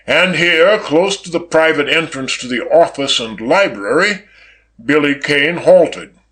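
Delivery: usual speaking voice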